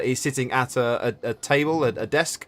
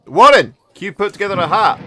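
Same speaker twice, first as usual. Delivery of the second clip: loud, higher call across the shop; 7 semitones higher, 10 dB louder than usual. Acted. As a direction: shout to Warren in the back. Calling to leatherworker.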